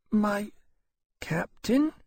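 a dying creature unsure who is there; uncertain. Acted. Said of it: "he said uncertainly".

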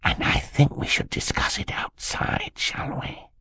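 breath-only conspiratorial whisper; very soft whisper. A narrator's reading. Uncle fears spies in the room, whispering. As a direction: whisper so they must hold their breath to hear. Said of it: "began speaking even softer".

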